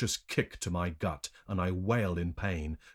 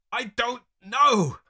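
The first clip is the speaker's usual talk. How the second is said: high, loud, drawn-out retort; exasperated high yell; snapping back; 9 dB louder, slower than usual. A narrator's reading. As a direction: snap back at your tormentors. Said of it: "I snap back at them".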